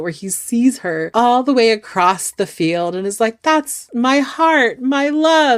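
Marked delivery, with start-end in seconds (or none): 3.4-5.6 high, slow, lovestruck gush; mock swooning. Groom seeing his bride across the field, swooning. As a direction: draw it out higher, melting with adoration.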